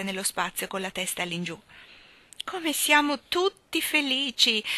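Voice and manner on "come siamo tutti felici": high, slow cry of happiness; joyous cry. A narrator's reading. Miss Tartina to the whirling company, joyous cry. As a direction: draw it out high with delight. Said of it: "gridò la signorina Tartina".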